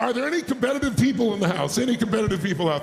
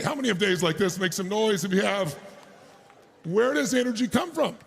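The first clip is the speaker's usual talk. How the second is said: normal talking voice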